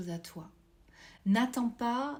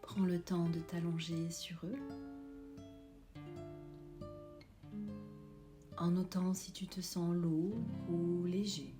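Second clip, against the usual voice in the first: guided meditation; 7 dB quieter than usual. Acted. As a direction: guide them gently into calm.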